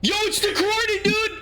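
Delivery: high, loud excited yelling; hyped shouting at the new teaser. Real, not acted. Host shouting at a surprise teaser, excited. Teaser drops during live show.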